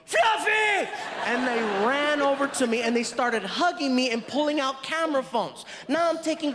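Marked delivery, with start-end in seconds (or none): 0.1-0.9 high excited shout of his nickname; excited yell. Retold or imitated. Casting crew jumping up to greet him, excited high shout. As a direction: squeal it like starstruck fans. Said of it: "all jumped up and yelled".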